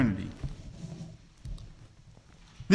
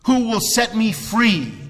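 raised and slow, a pleading cry; higher, drawn-out lament to God; 6 semitones higher, 4 dB louder than usual. Retold or imitated. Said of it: "he cried out".

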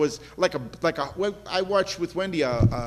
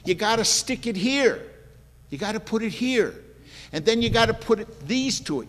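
ordinary talk

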